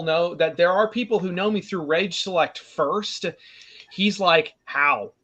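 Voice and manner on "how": exasperated How then a sharp louder Why; annoyed. Retold or imitated. [name] imitating Jeff's disbelief at his fans, annoyed. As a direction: imitate a baffled, irritated friend. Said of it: "He sounds annoyed".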